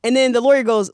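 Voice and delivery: normal talking voice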